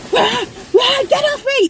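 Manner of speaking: frantic yell. Retold or imitated. Fighting him off, screaming.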